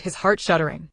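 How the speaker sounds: ordinary delivery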